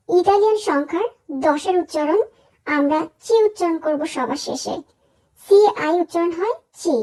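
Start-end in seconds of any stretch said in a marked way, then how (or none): none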